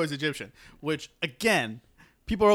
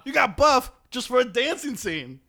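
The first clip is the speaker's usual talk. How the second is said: high-pitched comic indignation; mock outrage; 6 semitones higher than usual. Berating the character over a dancing scene, mock outrage. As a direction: protest in a high, incredulous voice.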